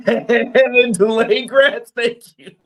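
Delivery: high, loud words through laughter; laughing. Real, not acted. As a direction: crack up while thanking them. Cracks up at the rant.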